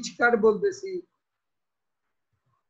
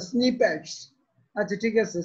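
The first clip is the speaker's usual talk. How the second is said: ordinary talk, nothing marked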